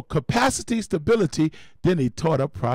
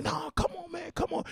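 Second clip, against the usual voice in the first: much higher, strained whine; pained protest; 8 dB quieter than usual. Retold or imitated. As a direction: whine it out through a toothache. Man in tooth pain protests.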